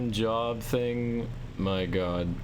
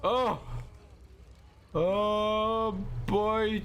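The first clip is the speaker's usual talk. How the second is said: surprised; 11 semitones higher, much slower than usual. Real, not acted. Sudden win in game.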